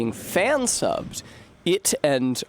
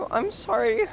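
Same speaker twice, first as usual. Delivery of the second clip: bimbo voice; 10 semitones higher than usual. Pretend. Apologizing in the bimbo voice.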